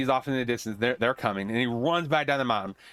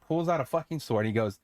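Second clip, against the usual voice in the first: usual speaking voice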